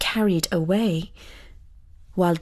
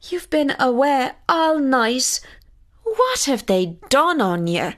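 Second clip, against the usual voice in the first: mother crying out; 6 semitones higher than usual. Acted. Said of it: "cried his mother".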